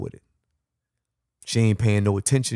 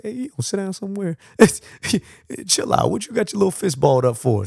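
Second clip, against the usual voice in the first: teasing, light and high; half-laughing, teasing a little one; playful coaxing; 10 semitones higher, faster than usual. Retold or imitated. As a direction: coax a mad kid to sit down. Calming an angry child, playful.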